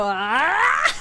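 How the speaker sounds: mock yell of agony; imitated agony yell. Imitating actor after kicking helmet, pained yell. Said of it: "yells in, like, agony".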